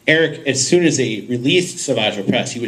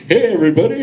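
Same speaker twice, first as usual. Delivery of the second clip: cheery mock greeting; 8 semitones higher, 4 dB louder, faster than usual. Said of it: "We're like".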